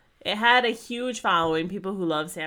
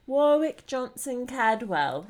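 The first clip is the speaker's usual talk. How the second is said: put-on British accent; 6 semitones higher, slower than usual. Co-host relishing an artist's name, mock British accent. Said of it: "say with a British ac".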